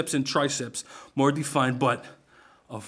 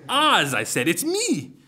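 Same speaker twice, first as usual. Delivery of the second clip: excited recognition; 7 semitones higher, 4 dB louder, slower than usual. Acted. Recognizing long-lost friend.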